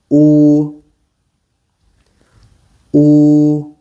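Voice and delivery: "Oo" with lips rounded, like the oo in food; Mandarin u vowel. Shown as a demonstration. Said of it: "you have to round your lips".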